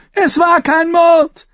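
very high, loud, drawn-out shriek; shrill denial. A narrator's reading. Captive denies murder to his accusers, shrill. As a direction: cry it out shrilly, stung. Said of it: "Seine Stimme klang schrill".

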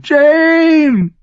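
very high, loud terrified scream. A narrator's reading. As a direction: scream his name in agony. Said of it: "hörte sie sich schreien".

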